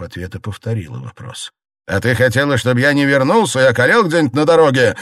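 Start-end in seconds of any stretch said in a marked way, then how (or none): 1.9-5.0 loud, high, rushing retort; angry shout. A narrator's reading. Husband snaps back at his wife's question, shouting. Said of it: "крикнул Дзялянь".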